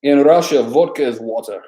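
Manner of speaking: mock-Russian proclamation; Russian accent. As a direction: play it as an amateur Russian accent. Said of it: "Is that a good Russian accent?".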